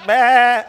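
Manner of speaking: loud, high laughing bleat; goat bleat. Retold or imitated. Preacher imitating himself speechless at the party, loud goat bleat. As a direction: blurt out a goat's meh through laughter. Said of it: "I was doing the goat boy".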